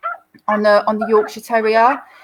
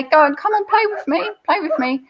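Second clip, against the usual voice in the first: much higher, eager puppy begging to play; excited dog begging its friend to play; playful dog voice; 9 semitones higher than usual. Retold or imitated. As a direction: squeak it out like a bouncy young dog pleading. Doberman voiced inviting play.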